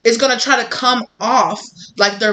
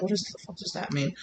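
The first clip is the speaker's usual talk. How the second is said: softer confused muttering; hushed mutter; 14 dB quieter than usual. Retold or imitated. Date Googling under the table, hushed mutter. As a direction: mutter it under your breath. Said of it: "Googling under the table".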